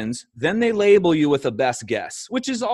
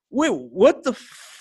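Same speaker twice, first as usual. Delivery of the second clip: slow, drawn-out shocked wait, what the; stunned disbelief; 6 semitones higher, slower than usual. Retold or imitated. Reacting to a Pfizer textbook, slow stunned disbelief. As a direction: let the shock stretch the words out.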